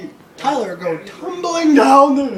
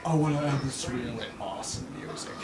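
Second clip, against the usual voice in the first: low, soft mock-dude voice; lightly mocking low-voiced impression; mimicking Ben; 10 semitones lower, 11 dB quieter than usual. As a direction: drop low and quiet to imitate a friend. Mimics Ben at his computer.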